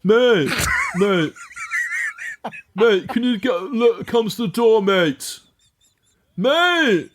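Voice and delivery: high, insistent mock shouts of a posh lad; imitated bloke shouting in the street; shouting for attention. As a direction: call up to a window, high and drawn-out. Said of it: "trying to get his attention and shouting".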